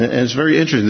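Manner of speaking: normal talking voice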